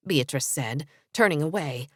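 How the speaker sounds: plain reading voice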